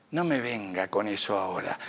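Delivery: San Pedro, grave y más suave, cortando la excusa; San Pedro cortante. Retold or imitated. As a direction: cerrar el tema con firmeza y en voz baja. (San Pedro corta en voz baja y grave la excusa del que llega)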